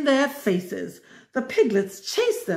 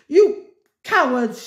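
high, loud furious sputter; sputtering insult; 5 semitones higher, 5 dB louder than usual. A narrator's reading. Cruella sputters at her failed henchmen. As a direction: spit the words out in outrage. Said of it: "she sputtered".

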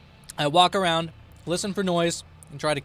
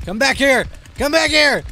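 high, loud calling, rising in pitch; shouting after someone; 9 semitones higher, 8 dB louder than usual. Acted. Killer chasing fleeing survivor, high shout. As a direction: yell it at someone running off.

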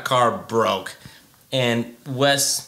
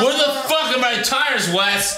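much higher, pressing yell at Wes; urgent demand; 9 semitones higher than usual. Shown as a demonstration. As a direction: demand your tires back. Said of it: "It's more urgent".

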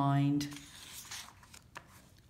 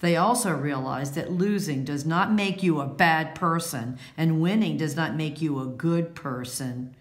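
ordinary delivery, nothing marked

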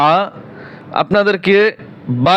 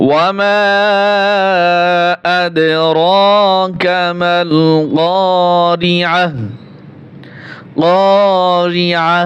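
slow, drawn-out chanted recitation; Quran recitation; much slower than usual. Shown as a demonstration. Teacher recites the verse for students, slow chanted recitation. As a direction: chant the verse slowly with tajweed.

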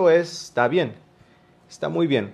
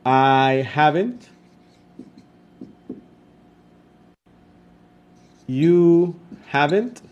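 slow, deliberate, each word apart; slow dictation; 4 dB louder, much slower than usual. Shown as a demonstration. Teacher dictating contractions to her students, slow and clear. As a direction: spell it out slowly so the class can copy. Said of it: "lo voy a poner acá arriba".

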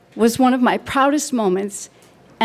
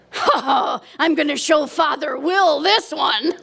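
gleeful grin; 3 semitones higher than usual. Retold or imitated. Mother shows off her boarding pass, imitated with grinning glee. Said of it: "she got a twinkle in her eye and a big grin".

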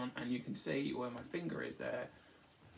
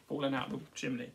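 ordinary delivery, nothing marked

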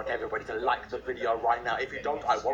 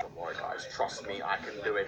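ordinary talk, nothing marked

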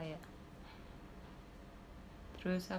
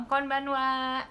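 higher, louder and slow "Ohayo gozaimasu"; teacher demo; 5 semitones higher, 4 dB louder, much slower than usual. Shown as a demonstration. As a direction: say it slowly and clearly for learners. Teaching Japanese greetings.

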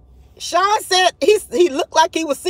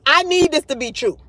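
usual speaking voice

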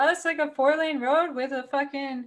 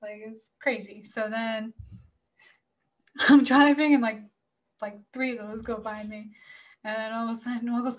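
ordinary talk, nothing marked

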